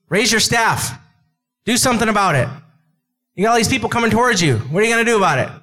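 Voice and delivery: lightly hammed-up impatient God; mock urgent God. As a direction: press him to act now. God voiced urging Moses.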